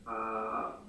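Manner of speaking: ordinary delivery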